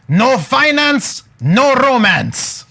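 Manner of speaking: put-on slow, emphatic French African accent; imitated mentor, drawn-out French African accent; Guy. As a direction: proclaim it like a motto in a thick Cameroonian accent. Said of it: "then he said like this".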